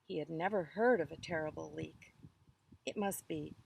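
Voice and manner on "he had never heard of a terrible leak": plain reading voice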